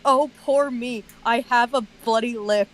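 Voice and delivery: ordinary talk, nothing marked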